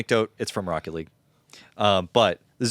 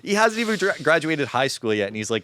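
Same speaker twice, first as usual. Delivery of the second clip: ordinary delivery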